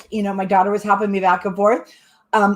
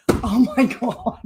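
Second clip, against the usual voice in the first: laughing; 2 semitones higher, slower than usual. Real, not acted. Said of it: "I cannot stop laughing".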